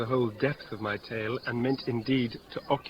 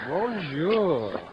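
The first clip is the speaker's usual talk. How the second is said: mock French greeting; 5 semitones higher, 3 dB louder than usual. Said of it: "There's a French salutation".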